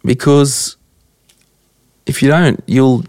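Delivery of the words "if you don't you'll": usual speaking voice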